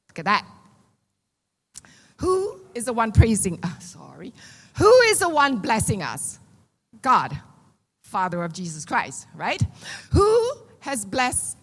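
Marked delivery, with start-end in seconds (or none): none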